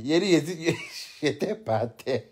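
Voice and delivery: ordinary talk, nothing marked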